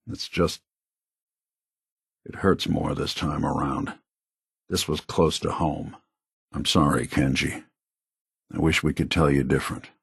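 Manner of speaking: low, halting regret; subdued sorrow. Acted. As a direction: break bad news gently, in a low voice. Grieving, apologising for bad news.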